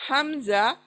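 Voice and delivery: हमजा with a glottal catch from the chest; हमजा pushed up from the chest; hamza from the chest. Shown as a demonstration. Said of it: "सीने से आवाज निकलेगी". (सीने से हमजा)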